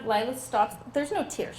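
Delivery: ordinary talk, nothing marked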